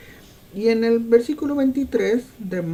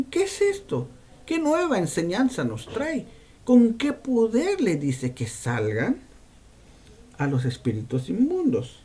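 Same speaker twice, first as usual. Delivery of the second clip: astonished questions; 3 semitones higher than usual. Acted. Preacher voicing listeners amazed at Jesus's power, rising astonishment. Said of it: "todavía dicen".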